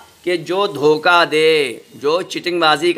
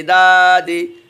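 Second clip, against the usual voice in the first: slow blended word; 2 semitones higher, 4 dB louder, much slower than usual. Shown as a demonstration. Teacher showing pupils the joined word दादी, slow and clear. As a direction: stretch दादी out slowly and a bit louder, syllables joined. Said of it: "पाल या पा".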